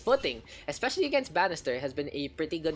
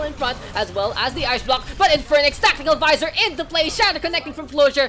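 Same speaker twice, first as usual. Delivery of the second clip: loud, higher final call; hyped casting; 8 semitones higher, 7 dB louder than usual. Acted. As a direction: shout the deciding play.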